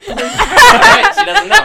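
squealing high laugh through words. Real, not acted. Laughing loudly.

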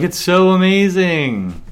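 high, loud, drawn-out imitation of raving diners; mock gushing. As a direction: stretch it out in exaggerated awe. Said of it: "and people are like".

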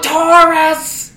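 loud, high, slow blurt; shouted blurt. Real, not acted. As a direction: yell the word out. Blurting answer to friends.

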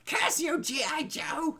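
high, shouted cartoon villain cry; Hitler voice. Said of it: "That'll just be my Hitler voice".

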